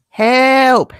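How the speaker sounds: loud, high mock plea; hammed-up call for help. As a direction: holler for help in jest. Nana's mock cry for help.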